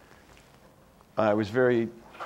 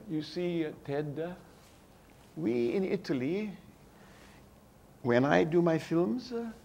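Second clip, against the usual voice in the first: put-on Antonioni's Italian accent; 6 semitones higher than usual. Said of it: "I'm not gonna do his accent".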